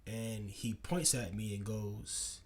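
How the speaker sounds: ordinary delivery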